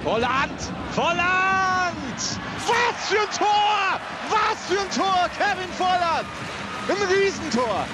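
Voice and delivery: high, slow goal scream; screaming goal commentary. Real, not acted. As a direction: yell the goal in full voice. Goal call in live commentary.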